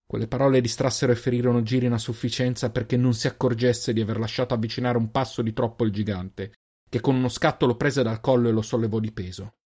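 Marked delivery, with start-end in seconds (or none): none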